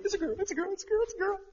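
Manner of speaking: very high-pitched gushing chant; hammed-up giddy repeating; giddy announcement. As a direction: squeal it over and over in pretend excitement. Imagined excited announcement.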